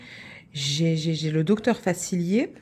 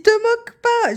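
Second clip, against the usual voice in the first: much higher and louder mock protest; husband's protest; 10 dB louder than usual. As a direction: whine it like the offended husband. Husband voiced, retold argument.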